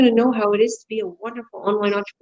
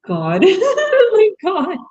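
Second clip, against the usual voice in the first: laughing exclamation; 11 semitones higher, 4 dB louder, much slower than usual. Retold or imitated. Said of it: "And I'm like".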